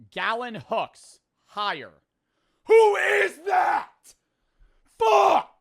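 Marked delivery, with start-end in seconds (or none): 2.7-5.5 very high, loud scream; drawn-out shriek of outrage; exasperated shout. Real, not acted. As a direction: scream it out in frustration. Angry outburst at unknown name.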